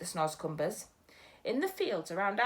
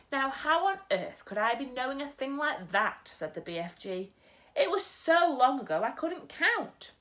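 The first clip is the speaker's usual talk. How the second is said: a gentle giant muddling his words; storyteller's BFG; 5 semitones higher than usual. Acted. BFG answers.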